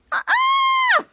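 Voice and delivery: very high, loud, sustained shriek; long piercing mock scream; acted scream. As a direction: scream at the exploding sink, hammed-up. Characters scream at exploding sink.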